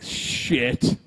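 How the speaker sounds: long, slow retold curse; drawn-out reaction. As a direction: stretch the word right out in mock dismay. Retold sinking reaction to the news.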